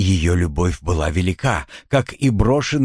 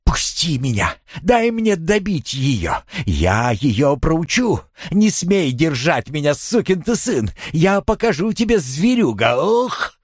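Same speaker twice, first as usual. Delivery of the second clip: high, straining, stretched furious cries; frenzied yelling; 6 semitones higher than usual. A narrator's reading. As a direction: struggle to break free while raging. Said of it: "сопротивляться и кричала".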